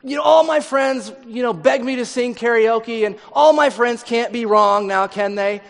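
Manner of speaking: high, loud and defiant; mock aggressive contestant voice; brazen contestant. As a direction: puff up like a deluded singer. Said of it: "go off and say".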